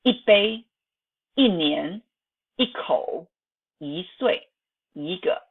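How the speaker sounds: 一杯, 一年, 一口 with falling 一, 一岁, 一个 with rising 一; tone change on 一. Shown as a demonstration. Teacher models examples, 一 in second or fourth tone. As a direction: drop 一 before 杯, 年, 口, lift it before 岁, 个. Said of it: "transformed into the second or fourth tone".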